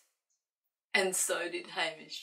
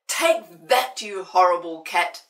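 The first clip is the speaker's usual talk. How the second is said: loud, gleeful angry yell; angry shout; 16 dB louder than usual. Acted. Old Sid yells at the cat while hosing him.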